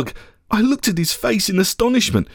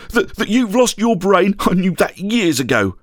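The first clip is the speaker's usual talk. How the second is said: ordinary delivery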